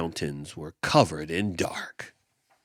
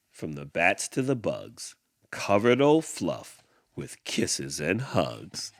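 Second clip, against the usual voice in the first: plain reading voice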